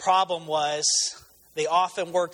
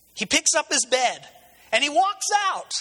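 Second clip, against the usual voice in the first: rising higher and higher to the payoff; excited climax; 8 semitones higher, 3 dB louder than usual. Real, not acted. Preacher telling the congregation the healed man walks out, building excitement. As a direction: build the thrill of the miracle.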